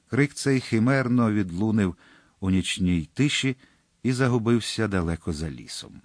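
usual speaking voice

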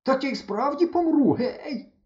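набагато вище, задихаючись; кричи, бо давить; переляк. A narrator's reading. Хлопця придавили кавунами. (хлопець під кавунами перелякано кличе)

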